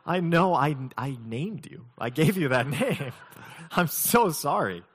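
laughing apology. Retold or imitated. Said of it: "And I'm like".

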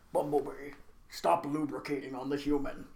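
low, subdued robot-leader voice; Peter Cullen impression. Optimus scolds Bumblebee on the human, low mock-heroic voice. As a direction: put on a low, grave hero-robot voice. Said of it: "trying to do your best Peter Cullen impression".

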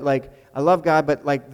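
ordinary delivery

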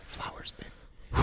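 slow drawn-out whisper. Pretend. Whispering a gift idea to co-host. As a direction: breathe the word slowly like a secret.

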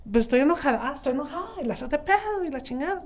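un poco más aguda, imitando su enojo de antes; imitando su yo impulsivo. Retold or imitated. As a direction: remeda a la [name] impulsiva, enojo exagerado a medias. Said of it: "antes era una [name] impulsiva". (imitación ligera de su yo enojada e impulsiva)

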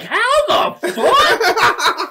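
high, loud, slowly stretched disbelief; hammed-up astonished yell; flabbergasted. Retold shock at toy appearing.

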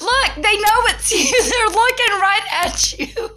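high, laughing delight; excited laughing. Retold or imitated. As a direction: cackle it out teasing her daughter. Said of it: "So I was like".

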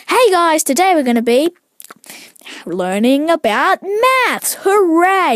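high, drawn-out mock YouTuber cheer; hammed-up enthusiasm, slow swoops on the key words; exaggerated video voice. Joking that he doesn't greet his mates so, hyped video voice. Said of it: "I don't actually speak like that".

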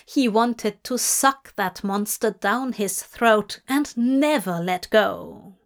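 usual speaking voice

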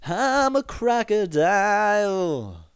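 high, slow, drawn-out cartoon croc; crocodile voice. Quoting the crocodile from the kids' movie, high and slow. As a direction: sing it out like a silly cartoon crocodile. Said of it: "the alligator's saying".

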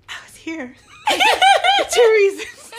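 high, loud, slow, breaking up laughing; nervous confession. Retold or imitated. Telling the lady why she came, nervously laughing. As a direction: squeeze the confession out through laughter.

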